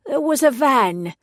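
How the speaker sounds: elderly London woman, lightly voiced; old pixie witness in a light London accent. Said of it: "she spoke with a London accent".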